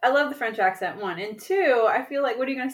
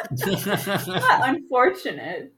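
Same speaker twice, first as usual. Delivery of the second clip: laughing; 3 dB louder, much slower than usual. Real, not acted. Teasing protest, laughing.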